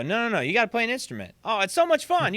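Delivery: high, hurried mock pleading; retold eager insisting. Acting out his old pleading.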